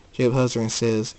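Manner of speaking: usual speaking voice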